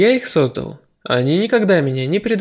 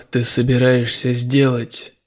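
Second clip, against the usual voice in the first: uneasy general; 4 semitones lower, slower than usual. A narrator's reading. General asks hero, uneasy.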